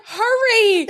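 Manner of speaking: high, loud panicked shout breaking into laughter; laughing panic. Retold or imitated. Shouting at the woman crossing as the van slides, laughing in panic. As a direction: yell it, half laughing, half terrified.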